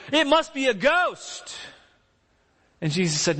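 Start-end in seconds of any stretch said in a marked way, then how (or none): 0.1-1.6 high, slow frightened gasp of words; spooked disciples. Retold or imitated. Disciples seeing Jesus on the water, high slow frightened words. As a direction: sound scared out of your wits. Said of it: "explanation they could have in their minds".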